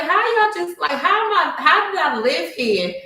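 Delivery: high, loud indignant questioning; outraged disbelief. Retold or imitated. Told she owes the management company, high loud outraged disbelief. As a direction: demand an answer, shocked and fuming. Billed fourteen hundred at move-out.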